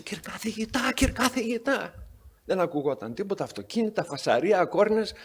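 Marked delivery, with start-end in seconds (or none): 0.0-1.9 calling out. Retold or imitated. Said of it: "να φωνάζει".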